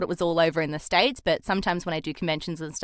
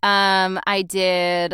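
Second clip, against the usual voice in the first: put-on American accent, chatting about the day; conversational American English accent; 3 semitones higher, 4 dB louder than usual. Shown as a demonstration. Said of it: "can you answer in an American accent".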